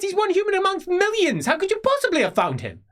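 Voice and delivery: high, loud, fast angry shouting; exasperated nephew yelling; furious outburst. Acted. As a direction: explode at her in disbelief. Nephew rages at the fool's errand.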